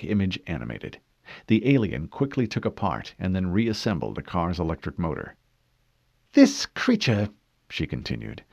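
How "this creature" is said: female interrogator voice. Acted.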